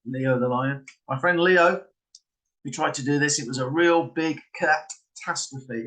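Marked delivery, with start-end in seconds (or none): 1.1-1.8 "Leo" higher and louder, pointedly planted; emphasized name. Shown as a demonstration. Trickster priming someone to think of lion, emphasized. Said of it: "you kind of emphasize certain words". 3.4-5.8 slow, weighting "real big" and the "cat" of "catastrophe"; emphasized words. Shown as a demonstration. Trickster planting big cat in the patter, emphasized. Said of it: "you kind of emphasize certain words".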